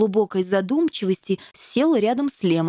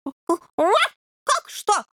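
much higher, outraged squeak; indignant flare-up; 10 semitones higher than usual. A narrator's reading. Puppy flares up at the devil, indignant. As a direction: bristle up at the question. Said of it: "взвился Роберт".

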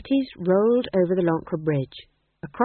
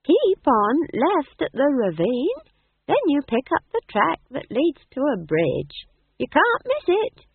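put-on high, slow, swooping old-woman voice; Nanny Ogg, prompt; 9 semitones higher, 4 dB louder than usual. A narrator's reading. Old woman giving travellers directions to the bridge, prompt. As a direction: give directions readily in a lilting high voice. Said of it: "said Nanny promptly".

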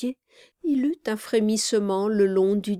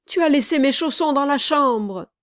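loud, high accusing outcry; cried out; 7 semitones higher, 7 dB louder than usual. A narrator's reading. Charlot accusing the man of leaving his slippers, crying out. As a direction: hurl the blame in a raised cry. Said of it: "cria Charlot".

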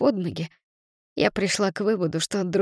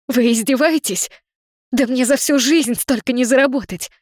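outraged cry; 5 semitones higher, 6 dB louder than usual. Acted. Heroine shocked by huge debt.

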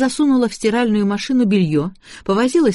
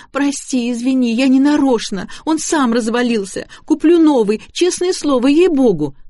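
crying on the phone; 7 semitones higher, 4 dB louder than usual. A narrator's reading. Sobbing girl apologizes on phone.

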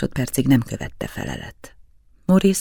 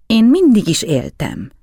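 put-on higher, louder stubborn child line; stubborn mumble; 8 semitones higher, 6 dB louder than usual. A narrator's reading. Krisztián stubbornly answering the adults, obstinate child voice. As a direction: insist like an obstinate boy. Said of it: "dünnyögte Krisztián csökönyösen".

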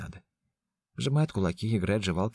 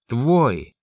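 furious shout; 5 semitones higher, 10 dB louder than usual. A narrator's reading. Ян кричит, наступая.

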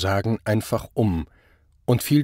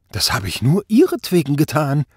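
high, louder, pleading accusation; desperate protest; 6 semitones higher, 5 dB louder than usual. A narrator's reading. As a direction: plead it back at him, voice climbing. Accused man pleads with inspector. (verzweifelter Protest)

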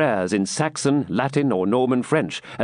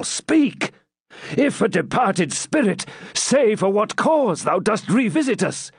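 high-pitched urgent entreaty; dramatic plea to the dead; awestruck adjuration; 8 semitones higher than usual. Acted. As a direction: beg the spectre to speak, voice lifted high. Cedric beseeches supposed ghost.

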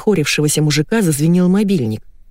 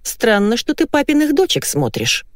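high-pitched stern remark; stern wife; 7 semitones higher than usual. A narrator's reading. Wife questioning husband's choice of TV show, stern. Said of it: "строго сказала супруга".

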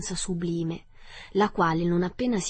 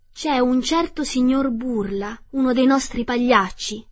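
higher, louder storybook princess; a hint of an animated princess voice; lightly voiced princess; 5 semitones higher, 6 dB louder than usual. A narrator's reading. Princess's dialogue in story reading.

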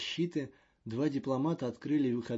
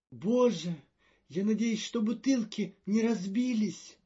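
worried; 10 semitones higher, 5 dB louder than usual. A narrator's reading. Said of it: "обеспокоенно сказал Маньян".

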